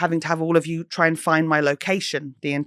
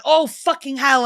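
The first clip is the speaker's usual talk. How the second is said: exasperated; 8 semitones higher than usual. Retold or imitated. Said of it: "And I'm like".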